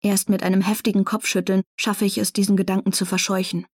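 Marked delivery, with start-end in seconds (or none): none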